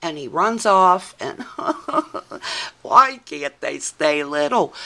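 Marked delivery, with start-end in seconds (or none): 1.5-2.8 breathy laugh. Real, not acted. Laughing at the story.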